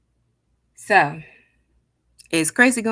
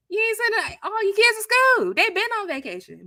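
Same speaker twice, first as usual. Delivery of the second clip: incredulous; 13 semitones higher than usual. Real, not acted. Mocking the absent kids.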